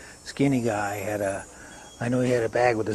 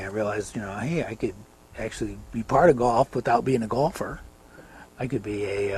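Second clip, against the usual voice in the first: normal talking voice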